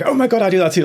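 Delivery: high, loud gush of recognition; excited recognition. Retold or imitated. Two new friends realising they share a habit, excited. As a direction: squeal it like finding a kindred spirit. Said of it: "we were like".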